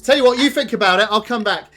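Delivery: high, loud busy barmaid; brisk and hearty landlady. Acted. Busy barmaid answering the player, high and loud.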